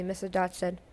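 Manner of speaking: usual speaking voice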